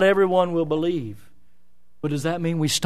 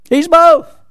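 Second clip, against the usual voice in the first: high, loud exclamation; preacher's ringing emphatic repeat; 10 semitones higher, 11 dB louder than usual. Real, not acted. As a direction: drive the answer home, much louder and higher.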